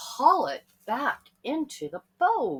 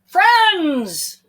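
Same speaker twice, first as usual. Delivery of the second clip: higher, louder call from far away; distant calling voice; 10 semitones higher, 14 dB louder than usual. A narrator's reading. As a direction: call out across the water. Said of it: "said the voice".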